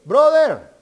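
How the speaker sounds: mock loud greeting. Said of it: "si uno le dice".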